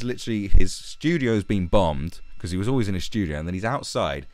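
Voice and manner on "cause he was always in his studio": usual speaking voice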